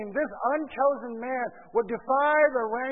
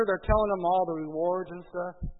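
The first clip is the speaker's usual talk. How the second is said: usual speaking voice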